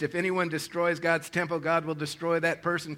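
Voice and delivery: ordinary talk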